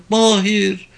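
put-on high, emotional old mother calling her son; high quavering cry of recognition; trembling voice. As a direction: call out with a trembling, overcome voice. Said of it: "diyebildi titreyen sesiyle".

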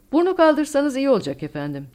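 high, loud and worried warning; anxious shout. A narrator's reading. Co-pilot warning the captain to lift the nose, anxious shout. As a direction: call out in alarm to the pilot. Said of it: "diye bağırdı yardımcı pilot endişeyle".

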